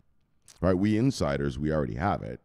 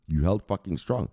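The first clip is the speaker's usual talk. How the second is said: ordinary delivery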